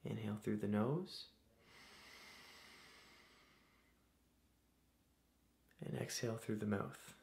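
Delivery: soft, unhurried meditation voice with long pauses; guided meditation. Acted. Host leading listeners through breathing to calm anxieties, guided meditation. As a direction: guide them gently through each breath, leaving space.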